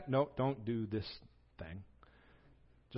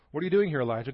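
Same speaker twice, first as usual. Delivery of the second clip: louder call that rises on doing; God calling to Elijah; 4 semitones higher, 7 dB louder, much faster than usual. Retold or imitated. God calls into Elijah's cave, louder and rising. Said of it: "God says".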